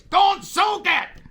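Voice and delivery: high, slow, shouted warning; frantic shout over the handset; retold yell. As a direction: yell it over the radio in a panic. Retold crash, yelling over radio.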